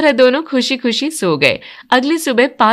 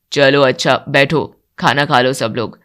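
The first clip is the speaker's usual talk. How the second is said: much lower, settled elder's voice; elderly patriarch; 9 semitones lower than usual. Acted. Father-in-law calling family to the table, much lower voice. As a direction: speak as the head of the house.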